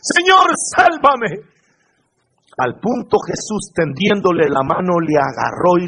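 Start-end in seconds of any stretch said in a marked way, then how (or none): 0.0-1.5 high, loud cry for rescue; frightened cry. Acted. Preacher as sinking Peter crying to Jesus, frightened shout. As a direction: call out urgently as if going under. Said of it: "le entró miedo y comenzaba a hundirse y gritó".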